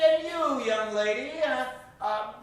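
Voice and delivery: ordinary delivery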